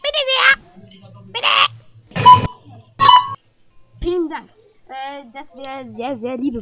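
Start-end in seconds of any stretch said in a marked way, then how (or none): 0.0-4.5 high, loud cashier voice. Acted. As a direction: serve the customer in a high sing-song voice. Cashier serving the grandma. (hohe, laute Kassiererstimme)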